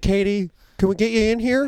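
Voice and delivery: lightly acted director on the phone; casting director calling out. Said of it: "they called her out".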